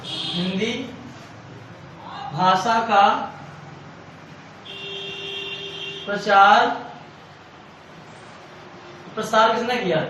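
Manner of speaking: long pauses between Hindi, भाषा का, प्रचार and प्रसार किसने किया; dictating. Shown as a demonstration. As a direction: read it out piece by piece for notes. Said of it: "आपको note करना है".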